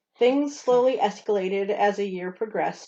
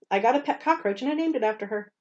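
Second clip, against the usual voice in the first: ordinary talk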